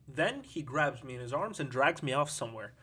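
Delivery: plain reading voice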